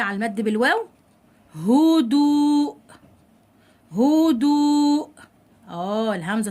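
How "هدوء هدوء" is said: higher, very slow held vowels; drawn-out model word. Shown as a demonstration. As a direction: dictate it slowly to the class. Teacher models example word twice. (نطق ممدود للكلمة)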